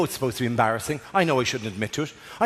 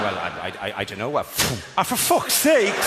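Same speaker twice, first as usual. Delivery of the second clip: flustered stammer; 7 semitones lower than usual. Pretend. Flustered reader failing test.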